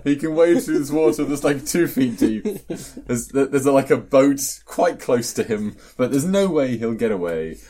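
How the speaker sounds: high, loud mocking sarcasm; sarcastic. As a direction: lay the irony on thick. Sarcastic retelling of villain logic.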